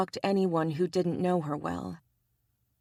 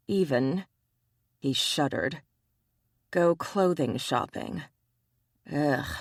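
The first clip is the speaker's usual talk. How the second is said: ordinary delivery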